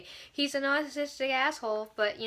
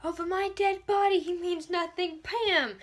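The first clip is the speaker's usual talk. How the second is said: higher, lightly voiced defiance; mock defiant retort; 7 semitones higher than usual. Clary defying Camille over Simon, a mild mock retort. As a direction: snap back at the villain, half-hammed. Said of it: "'Cause he's like".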